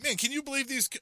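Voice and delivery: high-pitched exasperated complaint, cut off; mock exasperation. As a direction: whine it up high to the absent wife. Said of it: "I'm like".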